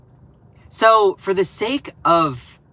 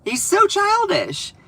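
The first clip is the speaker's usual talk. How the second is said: much higher, slow sing-song mockery; mocking high voice. Vlogger mocking the president's childishness in a high whine. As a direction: repeat it in a high taunting whine. Repeats line in mock voice.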